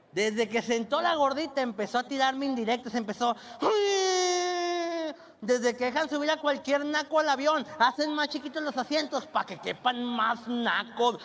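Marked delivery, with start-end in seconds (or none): 3.6-11.2 high, whining complaint with a cry; whiny chubby woman. Retold or imitated. Passenger complaining about luggage space, high whine. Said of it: "la voz chillona que te cae mal".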